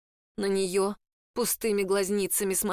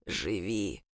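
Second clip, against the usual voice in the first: low, slow and soft rasp; eerie voice from inside her head; creaky mummy voice; 13 semitones lower, 5 dB quieter than usual. A narrator's reading. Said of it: "Скрипучий голос мумии эхом разнёсся". (скрипучий голос мумии)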